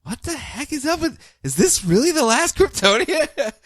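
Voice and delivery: high, hushed mock disbelief; puzzled aside in a small, high voice; baffled Rao. Rao watching the last Kryptonian strain, high baffled murmur. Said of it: "And Rao's just like".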